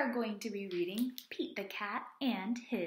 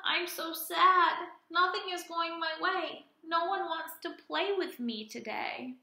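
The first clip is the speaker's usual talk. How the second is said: mock sad alligator; 6 semitones higher than usual. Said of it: "Alligator is feeling sad".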